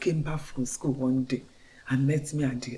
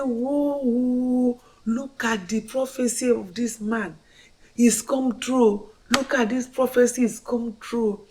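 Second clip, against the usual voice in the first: amazed; 6 semitones higher than usual. Retold or imitated. Woman realizing the prophecy came true, higher and amazed.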